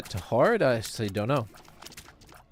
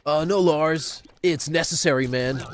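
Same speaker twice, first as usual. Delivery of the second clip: higher, gruff rock-star reply; 4 semitones higher, 4 dB louder than usual. Retold or imitated. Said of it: "What does [name] sound like?".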